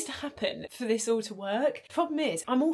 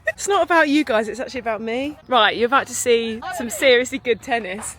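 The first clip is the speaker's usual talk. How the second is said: excited shout; 2 semitones higher, 14 dB louder than usual. Real, not acted. Announcing the tennis to viewers, loud and excited. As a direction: hype up the tennis match.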